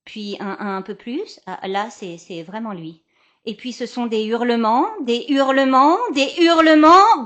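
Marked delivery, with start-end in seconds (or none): 3.5-7.2 building to a shout. Retold or imitated. Baby screaming, retold.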